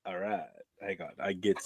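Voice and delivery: hushed and low, half to himself; quiet low voice. Retold or imitated. Salesman leans in low and quiet. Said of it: "and he goes".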